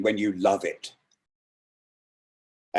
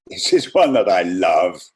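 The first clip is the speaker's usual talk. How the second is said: normal talking voice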